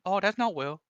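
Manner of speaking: high put-on voice settling back down; a tired joke in a squeaky voice; Will-like voice. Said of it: "You just became Will".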